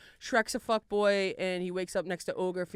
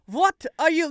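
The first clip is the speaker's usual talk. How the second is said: louder, botched Scottish turning Russian; accidental Russian accent; 5 semitones higher, 6 dB louder, slower than usual. Acted. Ogre startled by bedmate, Scottish accent gone Russian. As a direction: try Scottish but slip into Russian. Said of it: "that is Russian again".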